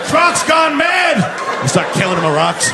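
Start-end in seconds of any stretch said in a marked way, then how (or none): 0.0-1.6 high, drawn-out panicked shout; scared caveman. Acted. Caveman warning the others round the fire, panicked high shout. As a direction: raise the alarm in a high, slow yell. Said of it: "Must have scared the hell out".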